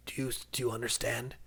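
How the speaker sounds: faint, breathy whisper of a dying god; dying god pleading with the hero in a whisper. Acted. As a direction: fade it to a whisper.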